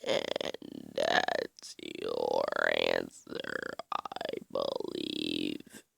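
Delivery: deep, dragged-out slowed-down voice; like a slowed recording played back; Frosty slow motion voice. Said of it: "slow motion voice".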